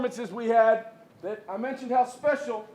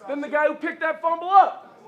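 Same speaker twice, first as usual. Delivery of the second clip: halftime pep speech; 4 semitones higher than usual. Real, not acted. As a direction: hype up the team. Coach hyping game ball winners.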